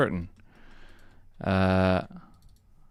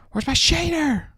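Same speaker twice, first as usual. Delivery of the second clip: much higher, frantic repeat; panicked high squeak; 4 dB louder than usual. Real, not acted. Streamer losing his shader, squeaking in panic.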